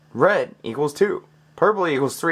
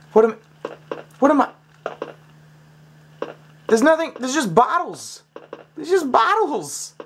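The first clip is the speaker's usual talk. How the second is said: exasperated whine; 12 semitones higher than usual. Real, not acted. Exasperated at puzzle.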